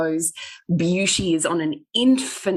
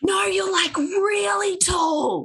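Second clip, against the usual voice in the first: mock barista; 13 semitones higher, much slower than usual. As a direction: insist like a gawking stranger. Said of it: "barista keeps going".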